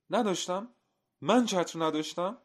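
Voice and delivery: high, loud echo question; indignant disbelief. A narrator's reading. Man to the butcher who doubts him, indignant. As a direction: throw it back, voice shooting up.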